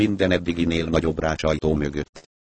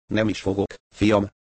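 plain reading voice

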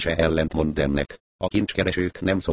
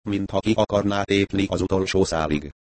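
ordinary delivery, nothing marked

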